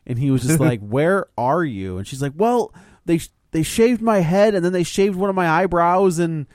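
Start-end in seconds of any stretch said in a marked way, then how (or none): 0.8-2.0 drawn-out, measured demand at normal pitch; slow insistent question. Retold or imitated. Husband presses his evasive wife on the phone, slow and insistent. 2.3-6.2 high-pitched, halting wife's voice; sheepish confession. Retold or imitated. Wife confesses to her husband on the phone, high and sheepish.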